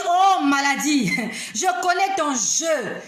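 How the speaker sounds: playful retold challenge; knowing taunt. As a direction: taunt it slowly, with a laugh. Taunts the illness in retelling.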